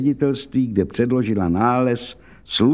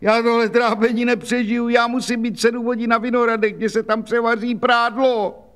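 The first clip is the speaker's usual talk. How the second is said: put-on much higher, keening complaint of an old washerwoman; wailing lament; 11 semitones higher than usual. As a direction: wail out her hard life, high and plaintive.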